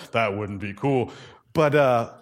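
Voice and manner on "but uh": ordinary talk, nothing marked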